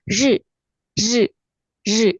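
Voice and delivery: "zhi" as Mandarin ri, tongue just slightly rolled; slightly curled r. Shown as a demonstration. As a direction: curl the tongue a little for each "zhi". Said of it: "huruf R juga digulung sedikit lidahnya".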